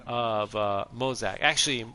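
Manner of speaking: ordinary talk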